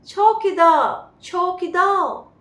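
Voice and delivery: a loud, slow call of चौकीदार in an Englishman's accent; English accent. Shown as a demonstration. Teacher shows how the Englishman calls the watchman, English accent. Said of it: "नहीं, ये कैसे बोल रहा है".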